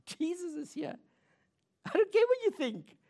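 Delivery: high-pitched defiant insistence; acted defiance, rising to an emphatic peak. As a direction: push back, voice leaping up high. Voices the woman's defiant thought.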